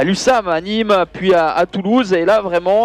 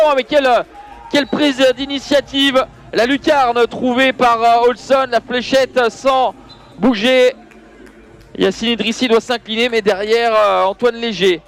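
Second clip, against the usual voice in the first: wrestling commentator; 5 semitones higher than usual. Real, not acted. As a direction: shout the play as it happens. Goal call in live handball commentary.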